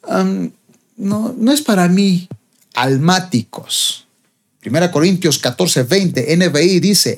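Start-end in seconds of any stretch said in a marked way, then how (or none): 0.1-2.3 slow, higher, hesitant mock voice; half-hearted quitter. One-week volunteer backs out of ministry, mock hesitant quitter. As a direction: imitate someone hesitantly backing out. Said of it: "gente que está una semana y luego".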